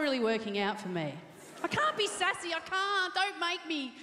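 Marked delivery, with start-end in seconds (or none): 1.6-3.9 higher, whiny mock pleading; playful hammed-up protest; mock panic. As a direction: plead not to be made sassy.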